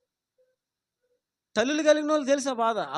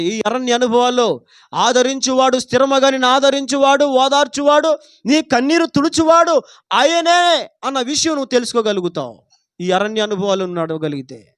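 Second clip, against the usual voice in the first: preaching at full force; 2 semitones higher, 10 dB louder than usual. Acted. Preacher to his congregation, proclaiming the psalm loudly. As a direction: preach it out to the whole hall.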